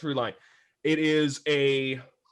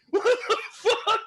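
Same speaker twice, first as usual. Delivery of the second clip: stunned laugh. Retold or imitated. Imitating stunned reaction.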